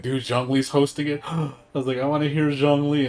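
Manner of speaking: high and loud, acting out giddy anticipation; retold fan excitement. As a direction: gush like a thrilled fan hearing great news.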